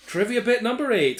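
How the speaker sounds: loud, high game show announcer. Acted. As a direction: announce it like a quiz host. Announcing segment title.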